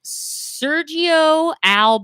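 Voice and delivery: Sergio Alb drawn out very slowly; "Alb" as a single syllable, slowly; novel name pronunciation. Shown as a demonstration. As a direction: stretch "Sergio Alb" out, saying Alb as one word. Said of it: "That's a new way to say it".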